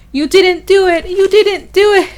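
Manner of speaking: high, loud, repeated pleading; Mariana's insistent cry. Acted. Dying wife haunting Carlo, crying out insistently. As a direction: insist it twice, high and forceful. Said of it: "Mariana asking him thousands of times".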